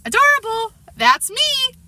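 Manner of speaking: put-on very high small-child voice; high little-boy voice, drawing attention; little brother. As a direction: pipe up as a pleased little kid.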